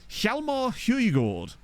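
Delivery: put-on a slow, drawn-out Scottish accent on the name; attempted Scottish accent, slow. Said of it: "When I hit the Scottish accent".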